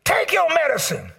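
high, loud barked orders; mock doctor yelling. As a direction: yell the orders like a rude doctor. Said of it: "he started yelling at you".

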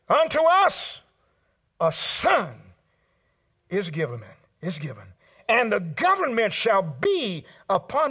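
slow declamation with surging emphatic peaks; preached scripture. Acted. Pastor proclaiming the prophecy to the congregation, declaimed slowly. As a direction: proclaim each phrase, pausing between them.

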